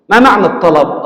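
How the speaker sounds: usual speaking voice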